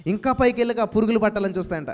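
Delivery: usual speaking voice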